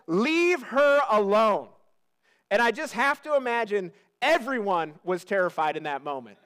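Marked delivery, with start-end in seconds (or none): 0.1-1.7 slow, measured command; unhurried, authoritative rebuke; firm command. Acted. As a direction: deliver it as a firm order, word by word. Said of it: "this is a command".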